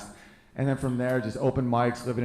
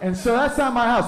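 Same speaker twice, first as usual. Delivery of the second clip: usual speaking voice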